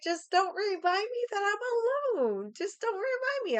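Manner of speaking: high, insistent acted pleading; fed-up plea, pitch leaping up; exasperated plea. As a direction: act out begging someone to drop it.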